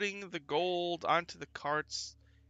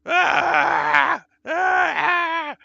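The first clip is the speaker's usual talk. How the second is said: loud, high acted death screams; screams of burning victims; 18 dB louder, much slower than usual. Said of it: "People are burning to death".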